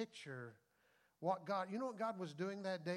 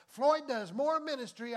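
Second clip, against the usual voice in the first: higher and louder, bursting; sudden excited preacher shout; excited outburst; 7 semitones higher, 11 dB louder than usual. Real, not acted. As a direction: call it out with delight. Exclaiming mid-sermon.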